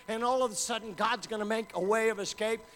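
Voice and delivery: loud, rising preacher's call; full-force preaching. Acted. Preacher driving the congregation toward God with full force. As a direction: build the sermon to a roar.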